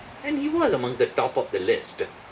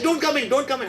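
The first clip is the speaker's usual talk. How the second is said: high, urgent plea to stay out; mildly panicked, pitched up; retold distress; 10 semitones higher than usual. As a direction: call out in mock distress. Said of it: "she's in sheer distress at that moment".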